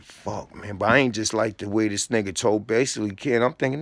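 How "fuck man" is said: whispered curse, retold from the hallway; under-breath curse. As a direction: curse it under your breath, barely voiced. Fuming outside, cursing to himself.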